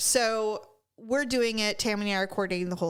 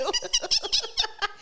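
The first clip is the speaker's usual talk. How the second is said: squealing laugh, much higher; high burst of laughter. Real, not acted. Retelling Tammy's line, she bursts out laughing. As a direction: break into helpless laughter.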